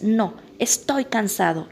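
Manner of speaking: usual speaking voice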